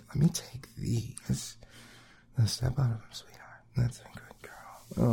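breathy intimate whisper, quick. Acted. To his partner, hungry whisper. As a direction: lean in and whisper the want.

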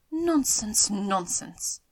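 exasperated wife's scoff; dismissive exclamation. A narrator's reading. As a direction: wave it away with emphatic impatience. Wife dismisses husband's proposal.

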